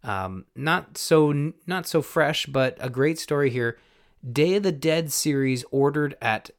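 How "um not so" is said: normal talking voice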